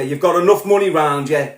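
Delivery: ordinary delivery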